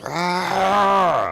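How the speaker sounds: high, loud roaring yell; battle scream. Acted. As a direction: let rip a full-throated war cry. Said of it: "And he goes".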